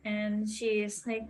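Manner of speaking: normal talking voice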